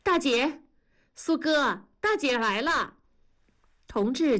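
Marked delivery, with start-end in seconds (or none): 0.0-2.9 high, eager calling out to her sister; thrilled shout announcing big sister; excited call. Acted. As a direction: call out happily, pitched up. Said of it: "兴奋地喊".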